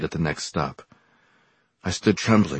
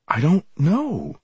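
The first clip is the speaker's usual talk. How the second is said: higher, slower, pleading denial; desperate; 11 semitones higher, slower than usual. Acted. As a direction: cry out the repeat in panic. Bewildered student, repeated denial.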